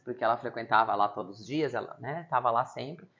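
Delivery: ordinary delivery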